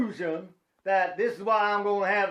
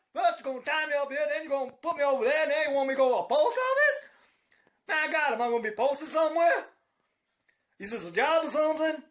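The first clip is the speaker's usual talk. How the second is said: high, fast, tumbling complaint; hammed-up flustered protest; mock exasperated rant; 7 semitones higher, faster than usual. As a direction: rattle it off in comic disbelief.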